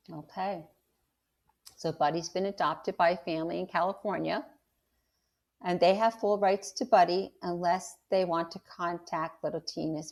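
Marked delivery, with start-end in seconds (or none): none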